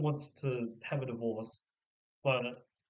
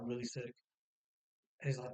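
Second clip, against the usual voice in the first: usual speaking voice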